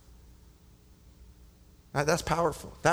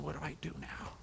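hushed despair; 13 dB quieter than usual. Retold or imitated. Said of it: "Darius at this point is just going".

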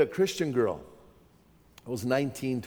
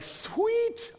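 very high, drawn-out gleeful squeal; acted delight; 3 dB quieter than usual. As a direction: replay a stoner's delight. Delight at surgery and drugs.

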